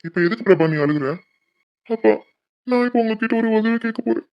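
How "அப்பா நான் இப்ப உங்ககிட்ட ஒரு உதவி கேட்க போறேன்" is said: high tearful plea to his father; அழுது கெஞ்சுதல். Acted. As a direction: voice lifted and wet with tears, begging. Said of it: "Prabha, எதுக்கு அழுகுற?". (அழுகையுடன் உயர்ந்த குரலில் கெஞ்சுதல்)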